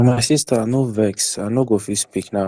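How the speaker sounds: usual speaking voice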